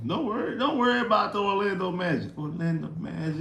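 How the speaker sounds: high, fast mock reassurance; mock hype. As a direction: toss it off high and quick like a hype man. Mocking fans' Magic chant.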